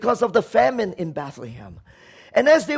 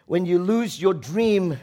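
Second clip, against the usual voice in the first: normal talking voice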